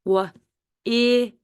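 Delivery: W-I-N as separate sounds "wuh, ih, nn"; sounded out. Shown as a demonstration. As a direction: stretch W-I-N sound by sound. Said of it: "is your first syllable".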